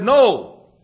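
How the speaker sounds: sudden loud high "No"; sharp refusal. Acted. Pond shouting at the sun to stop shining, loud refusal. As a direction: bark the no at the sun.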